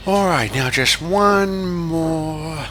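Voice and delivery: a slow, weary small engine; tired Thomas. Acted. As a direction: sigh through the last shunt.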